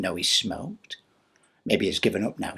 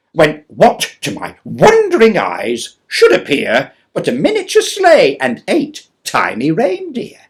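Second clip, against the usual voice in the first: louder, higher, excited reading; dramatic story narration; 5 semitones higher, 11 dB louder than usual. Acted. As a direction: build the excitement of the tale. Poem narration, building.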